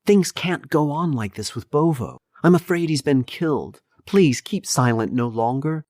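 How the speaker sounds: high, urgent plea of a worried daughter; pleading. A narrator's reading. Daughter begs father to act.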